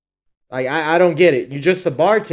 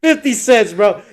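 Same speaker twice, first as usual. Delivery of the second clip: talking through real laughter, louder and higher; can't hold in the laugh while teasing; 8 semitones higher, 6 dB louder than usual. Real, not acted. Friend laughs at the stingy tip idea, laughing.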